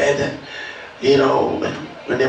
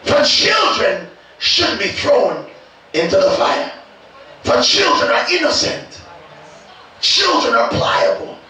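full-force preaching; 7 semitones higher, slower than usual. Real, not acted. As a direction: preach it with rising fervor.

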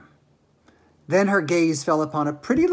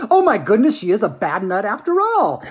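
loud, higher mock astonishment; astonished exclamation; 5 semitones higher, 7 dB louder than usual. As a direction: exclaim as if amazed by the verdict. Wonka exclaims at the verdict.

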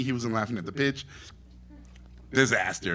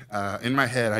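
ordinary delivery

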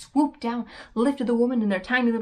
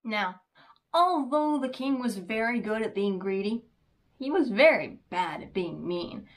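usual speaking voice

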